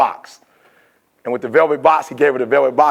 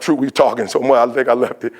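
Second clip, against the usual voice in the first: usual speaking voice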